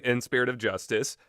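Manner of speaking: ordinary talk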